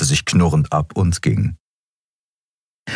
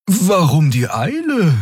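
high-pitched creature voice; puzzled Draxül; 13 semitones higher than usual. A narrator's reading. Xea asks about the hurry, puzzled Draxül voice.

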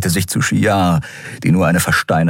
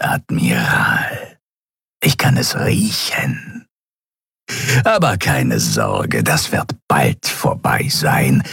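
sinister reptile cultist. Acted. Reptile nose to nose with the admiral, menacingly taunting. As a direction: savour the threat in a creepy croak.

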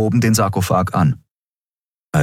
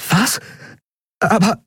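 shocked; 13 semitones higher than usual. A narrator's reading. Shocked woman.

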